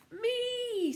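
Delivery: very high, loud screeching; owl screech. Acted. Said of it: "screeched the owl again".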